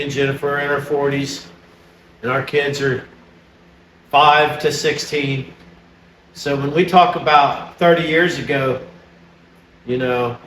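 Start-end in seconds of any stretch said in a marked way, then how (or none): none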